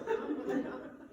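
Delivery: high cackle; wicked cackle. Acted. Thieves laughing over the loot, high cackle. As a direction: cackle in glee.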